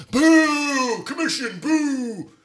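high, soft drawn-out boos; hushed high-pitched jeering; mock crowd booing. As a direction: boo it like a distant offended crowd. Mimics crowd booing a word.

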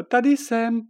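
high, loud call from the window. Acted. Princess Žofie calling from the window, high and loud. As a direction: call up to them from below.

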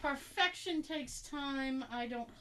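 distant, off-mic voice; far from mic. Real, not acted. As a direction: speak from across the room. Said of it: "You sound like you're far away".

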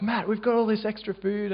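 high cheerful call of welcome; excited welcome. Retold or imitated. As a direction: call out the offer happily. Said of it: "they were like".

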